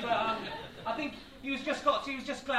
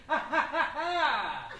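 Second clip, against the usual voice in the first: higher, measured theatrical "ha ha ha"; triumphant stage laugh; 4 semitones higher, 5 dB louder, slower than usual. Acted. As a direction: gloat with a fake laugh. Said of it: "the tramp jumped up and went".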